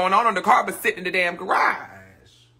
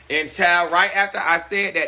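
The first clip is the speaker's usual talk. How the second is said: ordinary talk, nothing marked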